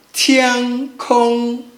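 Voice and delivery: TIAN KONG, slow flat syllables; first tone. Shown as a demonstration. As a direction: hold tian and kong level, each drawn out. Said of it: "make sure it's flat".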